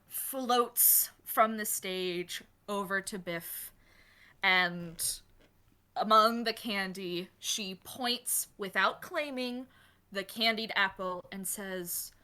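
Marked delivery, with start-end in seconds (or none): none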